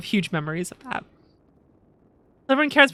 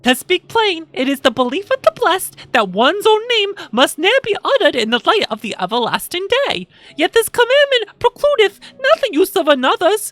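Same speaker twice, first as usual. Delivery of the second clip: loud, high, solemn archaic speech; archaic NPC voice; 6 semitones higher, 5 dB louder than usual. Acted. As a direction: declaim it like an old prophet. Reads game dialogue in archaic voice.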